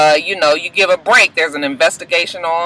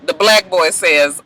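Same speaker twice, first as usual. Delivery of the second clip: ordinary talk, nothing marked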